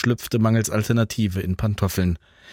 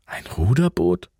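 surprised; 9 semitones higher than usual. A narrator's reading. Franz hears the rowboat plan.

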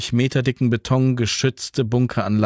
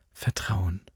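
soft, low affirmation; soft confirmation; 3 semitones lower, 8 dB quieter than usual. A narrator's reading. Alva answering Jan softly. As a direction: quietly agree. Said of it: "bestätigte Alva".

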